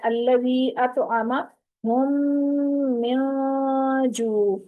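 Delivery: Quran recitation, drawn out slowly. Shown as a demonstration. Teacher recites the verse slowly for students. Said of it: "आयत number four में है".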